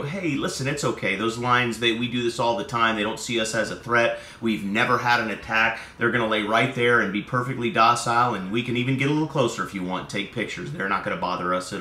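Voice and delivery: low, soft and reassuring; calm reassuring guide. Retold or imitated. Tour guide calmly reassuring nervous tourists, soft and low. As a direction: settle nervous tourists gently. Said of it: "if your tour guide said to you".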